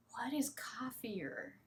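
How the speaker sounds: slow, whispered baffled question; puzzled whisper. Acted. Teacher voicing a confused learner, puzzled whisper.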